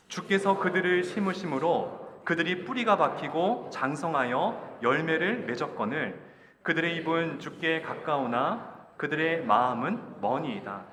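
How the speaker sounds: loud, slow, measured verse reading; scripture reading aloud. Acted. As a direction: lead the congregation through the verse, drawing out each phrase. Leads congregational scripture reading. (천천히 힘주어 읽는 성경 봉독)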